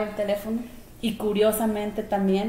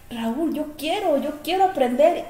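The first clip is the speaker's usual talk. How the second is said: retold moved longing; 5 semitones higher than usual. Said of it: "y yo lloré".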